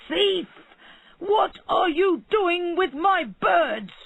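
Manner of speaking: high, loud and slow furious yell at the thief; angry shout. A narrator's reading. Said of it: "he shouted".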